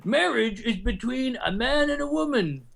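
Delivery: high, slow mock proclamation; mock traditionalist. Podcaster parodying traditional views on marriage, mock pompous. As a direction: declare it like a parody of a bigot.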